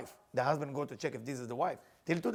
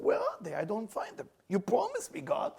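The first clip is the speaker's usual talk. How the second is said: anguished plea; 7 semitones higher than usual. Acted. Avraham pleads in anguish.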